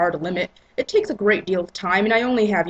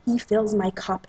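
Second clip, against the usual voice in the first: ordinary delivery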